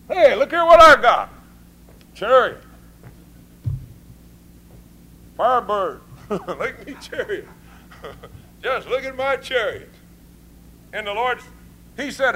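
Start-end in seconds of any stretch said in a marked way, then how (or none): 0.1-9.9 loud, laughing brag about the chariot; boastful show-off. Acted. Israelites bragging about their chariots, laughing boast. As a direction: show off your new ride with a laugh.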